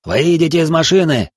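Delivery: loud, high barked order; shouted police command. Acted. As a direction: yell the order like a cop. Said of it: "прокричали полицейские хором".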